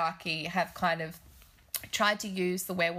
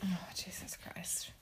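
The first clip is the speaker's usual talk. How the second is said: slow breathy whisper of disbelief; a whispered groan at the film; whispered dismay; 13 dB quieter, slower than usual. Real, not acted.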